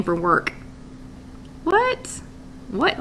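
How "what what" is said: high, startled what; surprised what. Real, not acted. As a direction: react in disbelief, pitching way up. Shocked at Betty's early alarm.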